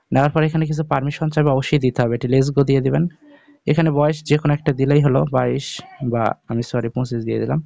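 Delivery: normal talking voice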